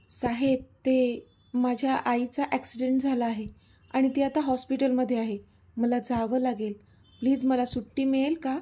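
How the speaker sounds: ordinary delivery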